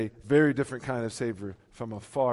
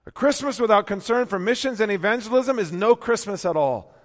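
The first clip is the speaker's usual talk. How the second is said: loud, high, ringing preacher's climb; full-voiced pulpit proclamation; preaching at full force; 10 semitones higher, 10 dB louder than usual. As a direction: lift it up and proclaim.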